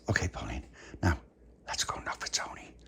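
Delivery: secretive whisper to a friend; hushed, conspiratorial whisper. Acted. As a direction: whisper it so no one hears. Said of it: "why are you whispering".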